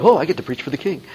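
light parody of eager delight; mock awe. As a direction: imitate a thrilled, starstruck preacher. Mimics an awestruck preacher he wasn't.

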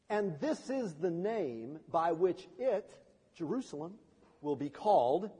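slow, weighty proclamation with pauses; solemn scripture reading. Acted. Preacher reading Jeremiah's promise to the congregation, solemn. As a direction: read each phrase out deliberately.

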